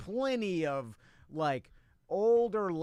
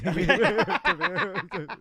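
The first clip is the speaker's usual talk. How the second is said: laughing; 2 semitones higher, 7 dB louder than usual. Real, not acted.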